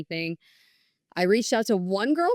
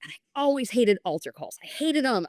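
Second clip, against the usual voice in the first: ordinary delivery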